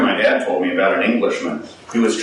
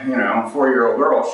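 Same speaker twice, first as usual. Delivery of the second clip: ordinary talk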